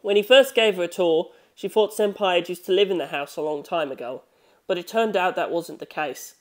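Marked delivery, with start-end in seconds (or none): none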